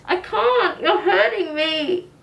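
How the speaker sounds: high, loud sobbing plea; crying out. Acted. Boy begging the doctor to stop, crying loudly. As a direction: wail it through tears.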